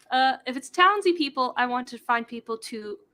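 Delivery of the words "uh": ordinary delivery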